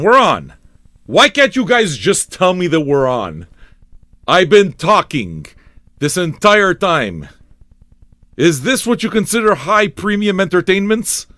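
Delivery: finally, we're on, vent it; exasperated outburst. Real, not acted. Finally told they're live.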